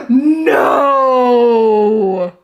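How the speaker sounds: loud, high drawn-out wail of defeat; slow despairing shouts; real dismayed wailing; anguished cry. Real, not acted. Lost the game again.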